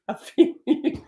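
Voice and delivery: high, squeaky, airy voice; overdone fellow-feeling; mock sympathy. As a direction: let it rise into a thin squeak. Retold sympathy tipping into laughter.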